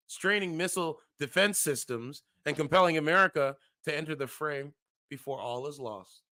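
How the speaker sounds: measured news-style reading of an article; formal reading of a grim forecast; article read aloud. Acted. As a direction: read it like a serious report.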